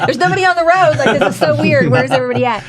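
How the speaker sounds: high, loud wonder at empty roads; high, loud retold amazement; amazed. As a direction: marvel out loud like a kid skipping school.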